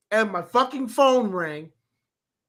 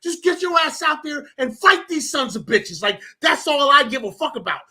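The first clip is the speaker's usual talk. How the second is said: high-pitched, fired-up fan rant; rally the team with rising excitement; hyped rant; 5 semitones higher than usual. Real, not acted. Fan urging his team to keep fighting, hyped rant.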